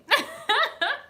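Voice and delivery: high squealing laugh; a sudden high squeal of laughter. Real, not acted. Laughs at blown away.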